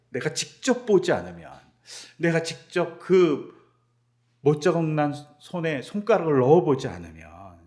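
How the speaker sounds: loud, mocking the disciple's own words; angry mockery. Teacher mocking the doubter's demand, loud and angry. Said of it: "막 분노하면서".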